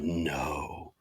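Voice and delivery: low, soft, drawn-out no; retold quiet dismay. Rider retells seeing the Escalade, quiet mock dismay. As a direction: say it under the breath, low and sinking.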